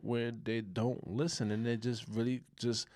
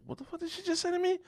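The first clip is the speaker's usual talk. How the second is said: very high, incredulous outburst; mock a man stopped short by shock; shocked disbelief; faster than usual. Man reacting to a woman's outlandish remark, shocked disbelief.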